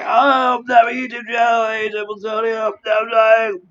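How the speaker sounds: yelling in pain, high; panicked shout. Pretend. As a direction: shout it out like you're dying. Imagined panicked patient at hospital.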